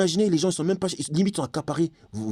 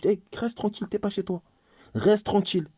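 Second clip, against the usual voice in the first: mock local telling off; 8 semitones higher than usual. Voicing locals telling foreigners off.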